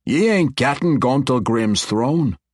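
slow, firm dwarven refusal; firm dwarf. Acted. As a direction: lay it down slowly, a hint of dwarf. Dwarf king refuses throne.

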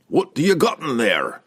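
loud, high call. A narrator's reading. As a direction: call in from the doorway. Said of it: "came Brunner's call".

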